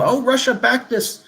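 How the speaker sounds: scoffing parody of a news alarm; mocking. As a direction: sneer at the headline like a gossip. Mocking the media headline.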